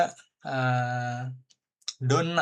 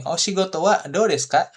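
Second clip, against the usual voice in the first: raised, louder chatty asking voice; friendly question; 5 semitones higher, 5 dB louder than usual. Shown as a demonstration. Teacher acting a friend asking about work, higher and louder. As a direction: ask brightly, like meeting a friend. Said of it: "Kayak gini".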